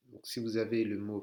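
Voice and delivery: ordinary talk, nothing marked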